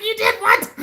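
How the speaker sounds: stunned, half laughing; disbelieving squeak at the dispatcher; shocked disbelief. Retold or imitated. Retold shock they hung up.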